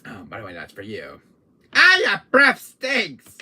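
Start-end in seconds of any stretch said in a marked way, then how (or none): 1.7-3.4 loud, high, drawn-out complaint; hammed-up shout of disgust; disgusted yell. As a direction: cry out at the stench, slowly. Recoiling from bad breath.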